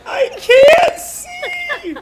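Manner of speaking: very high, loud, drawn-out wail; hammed-up shriek, half laughing, half crying; mock crying scream. Son imitating mom blinded by laughter in the rain, mock crying scream. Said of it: "and she's crying".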